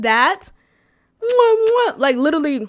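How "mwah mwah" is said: put-on high, smacking baby-kiss noises; imitating his kissing noises on her cheek, high smacks; imitated kiss smacks. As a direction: smack loud kisses like kissing a baby's cheek. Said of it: "imitate the noises".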